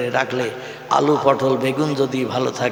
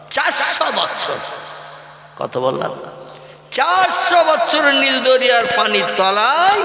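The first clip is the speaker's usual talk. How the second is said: emphatic preaching; 8 semitones higher than usual. Real, not acted.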